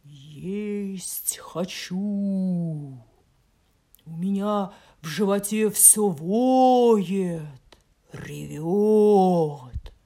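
loud, slow, eerie wolf; a starving wolf moaning; howling wolf voice. Acted. Hungry wolf speaks. (голодный воющий волк)

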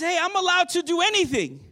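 loud, high, cocky claim; defiant claim. Retold or imitated. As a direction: toss it out like a crowd that won't listen. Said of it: "That's their response".

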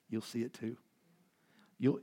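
ordinary talk, nothing marked

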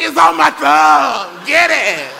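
slow, loud, high desperate shout; laughing mock panic; panicked yell. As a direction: yell for someone to get it off you. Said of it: "I was like".

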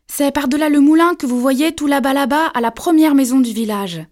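put-on a child eagerly showing the way; eager little girl. A narrator's reading. Little girl eagerly tells the wolf the way, high and loud.

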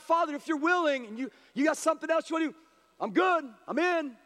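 high, urgent plea from the human side; crying out. Retold or imitated. Jesus praying from his humanity side, crying out. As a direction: plead it high and hurried, as if bargaining. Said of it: "He's crying out for that".

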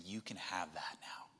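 soft, low whisper; Jesus gently whispering to his disciples; whispered promise. Acted. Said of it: "and he's saying".

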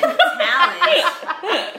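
high, loud, slow words broken by laughter; laughing. Real, not acted. Telling friends the wild driver story, laughing loud and high.

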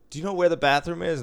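imitating a hurried gambler asking for the bathroom; hurried stranger. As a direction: rush the question like someone desperate for the bathroom. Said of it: "he's, like, in a hurry, and he goes".

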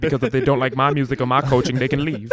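put-on Southern preacher-like speech; Jamel. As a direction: imitate a self-assured reverend laying down the law. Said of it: "Pretty good impression".

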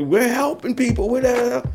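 high imitated shout of protest; mock her yelling back at the cop; retold yell. Wife refusing to stand against the wall, high protesting yell. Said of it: "she's yelling to me".

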